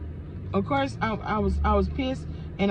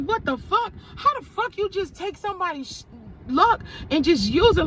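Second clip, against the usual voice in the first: high-pitched retold outrage; pissed-off friend; 8 semitones higher than usual. Jamaican friend reacting to the stolen luck, pissed off. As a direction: voice the friend's indignant disbelief, pitched up. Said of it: "she was pissed off her damn self".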